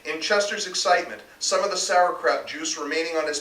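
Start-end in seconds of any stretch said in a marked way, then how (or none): none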